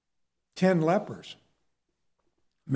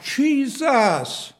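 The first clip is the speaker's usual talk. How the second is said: higher-pitched, moderate mock scream; retold scream; 5 semitones higher than usual. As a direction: imitate a desperate cry, pitched up. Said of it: "He was screaming it out".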